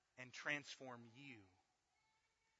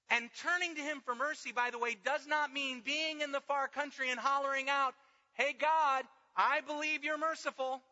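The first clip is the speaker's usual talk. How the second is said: loud, high, driving sermon; full-force preaching; 11 semitones higher, 15 dB louder than usual. Acted. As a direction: preach it out hard to the room.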